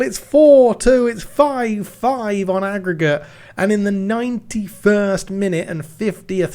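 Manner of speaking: rising to a shouted goal call; excited commentary. Real, not acted. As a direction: build up and yell the goal. Live goal commentary building to shout.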